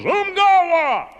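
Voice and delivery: high, loud, slow umgawa bellow; Tarzan command. Retold or imitated. Tarzan commands Tantor from the vine, loud slow bellow. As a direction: bellow umgawa like a jungle king. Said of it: "and goes".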